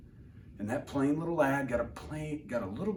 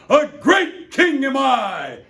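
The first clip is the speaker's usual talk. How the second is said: slow, high, loud proclamation; pompous king; 11 semitones higher, 12 dB louder, slower than usual. Acted. Yertle up high, declaring himself king, grandly. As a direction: savour every word of your greatness. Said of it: "saying over and over".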